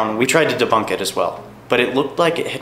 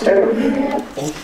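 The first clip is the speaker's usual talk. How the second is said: stunned; 8 semitones higher, much slower than usual. Real, not acted. Stunned after event.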